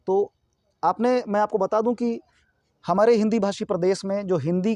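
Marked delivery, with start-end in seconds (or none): none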